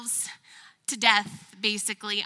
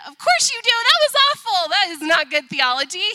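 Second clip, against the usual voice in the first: much higher and louder, amused self-mockery; laughing exclamation; 8 semitones higher, 9 dB louder than usual. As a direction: burst out admitting you were terrible. Replying to husband's joke.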